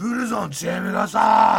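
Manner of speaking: high, loud imitation of a loud bar talker; mock shouting. Imitating a loud man in an izakaya, mock shouting. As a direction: belt it out like a shouty drinker. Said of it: "絶叫して喋るような人いるじゃん".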